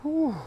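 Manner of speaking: high breathy whew; high exhale. Real, not acted. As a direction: blow out after effort. Exhale after workout.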